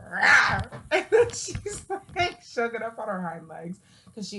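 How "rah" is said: loud mock cat growl; imitating a cat. Owner imitating her cat pouncing on his sister, mock growl. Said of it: "He'll be like".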